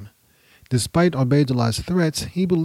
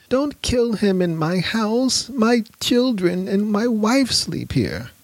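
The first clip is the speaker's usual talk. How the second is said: high, slow, nervous begging; nervous pleading; 10 semitones higher, slower than usual. Acted. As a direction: beg them not to do it. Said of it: "said Hani nervously".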